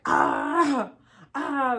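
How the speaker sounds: loud pained scream of a waking patient; retold scream. Patient waking mid-surgery, screaming out in pain. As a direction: yell out in pain as he wakes. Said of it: "like, screaming".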